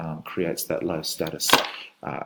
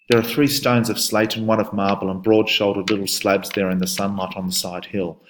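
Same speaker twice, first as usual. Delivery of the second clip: louder, poetic reading of the husband; dramatic poem reading; 4 dB louder than usual. Shown as a demonstration. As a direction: read it aloud with weight, like a grieving husband. Teacher reads husband's speech aloud.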